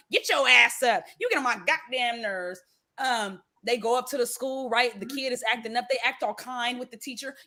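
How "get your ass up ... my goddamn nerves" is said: loud, sudden order to get up; retold yell of a fed-up mama; mama's scolding yell. Mother rousing her son as she scolds him, mock yell.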